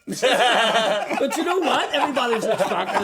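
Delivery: real giggling as he gets going; laughing storyteller. Real, not acted. Laughing while telling story.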